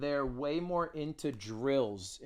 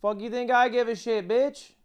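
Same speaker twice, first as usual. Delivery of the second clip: high, loud outburst; angry rant; 10 semitones higher, 9 dB louder than usual. Pretend. Streamer defending his pauses to a viewer, angry shouting. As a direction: yell defiantly at a complaining viewer.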